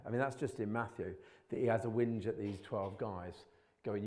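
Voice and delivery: ordinary talk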